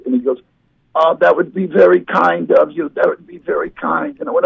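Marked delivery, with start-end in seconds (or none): 0.9-4.2 imitated old man, higher-pitched; elderly man. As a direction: play a grateful, stooped old man. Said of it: "those guys with scoliosis".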